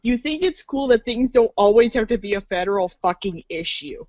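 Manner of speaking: ordinary talk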